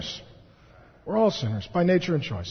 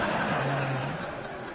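soft laugh; 5 semitones lower, 9 dB quieter than usual. Real, not acted. Laughing at church lists joke.

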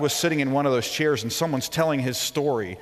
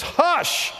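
high, loud sharp "Hush!"; Jesus rebuking the storm; commanding hush; 6 dB louder than usual. Pretend. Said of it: "Jesus stands up and says".

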